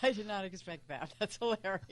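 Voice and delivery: helpless soft laugh under the words; real laughing. Real, not acted. Co-host reacts to the joke's punchline, laughing as she speaks.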